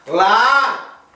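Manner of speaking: very high, very loud held yell; shouted answer. Acted. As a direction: yell the eye-chart letter at the doctor. Paciente grita la letra al oftalmólogo.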